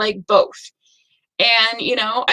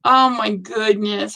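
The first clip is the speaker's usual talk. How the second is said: ordinary talk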